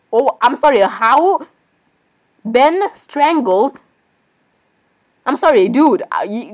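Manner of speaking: ordinary talk, nothing marked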